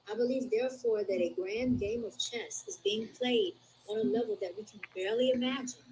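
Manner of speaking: ordinary talk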